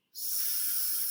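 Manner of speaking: "S-" as a long hissed s alone, no vowel; hissed s sound demo; first sound. Shown as a demonstration. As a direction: isolate the s sound of snail. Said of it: "What is the first sound in snail?".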